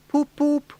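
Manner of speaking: high, wailing car-horn poop; a high mock motor horn. A narrator's reading. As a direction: honk it like a far-off horn. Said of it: "wailed like an uneasy animal in pain".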